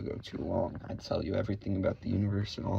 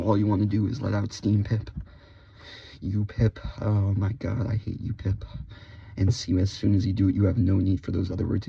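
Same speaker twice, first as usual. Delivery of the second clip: much louder, letting off steam; loud venting; 5 dB louder than usual. Acted. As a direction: belt the insults out. Venting insults with gusto.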